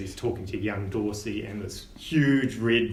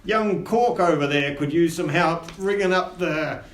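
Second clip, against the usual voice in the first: gruff first mate; 5 semitones higher, 6 dB louder, slower than usual. Acted. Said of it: "Scabbers says".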